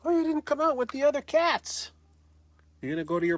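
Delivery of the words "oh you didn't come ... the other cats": high, sing-song voice for a cat; sweet high pet-talking voice. Real, not acted. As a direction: coo at the kitty from up high.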